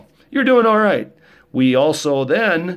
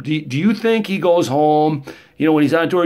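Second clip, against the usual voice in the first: ordinary talk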